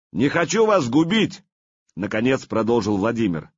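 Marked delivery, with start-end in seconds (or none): 0.1-1.4 loud declaration. Acted. Vladimir declares mercy.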